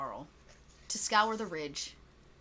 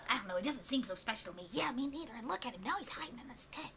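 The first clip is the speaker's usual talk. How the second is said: goblin chatter; 4 semitones higher, 5 dB quieter, faster than usual. Acted. Goblins gossiping at the fire.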